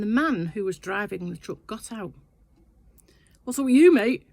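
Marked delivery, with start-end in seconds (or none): 3.4-4.3 loud, high, aggressive challenge; aggressive bloke. Retold or imitated. Truck driver confronting the husband, loud aggressive challenge. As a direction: bark it at him like a squaring-up bloke. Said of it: "He was very aggressive".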